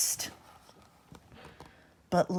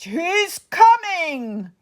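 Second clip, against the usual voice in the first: alarmed giantess; 8 semitones higher, slower than usual. Acted. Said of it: "cried the giantess".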